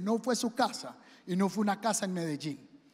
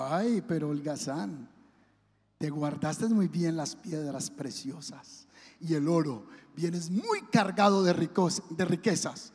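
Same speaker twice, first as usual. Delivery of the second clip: lobo grave y lento; un lobo astuto que tantea a su presa; voz de lobo; 7 semitones lower than usual. Acted. El lobo habla al holgazán. (el lobo hambriento le habla grave al holgazán)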